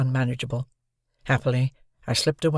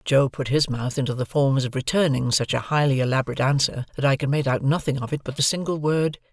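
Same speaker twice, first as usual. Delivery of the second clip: ordinary delivery, nothing marked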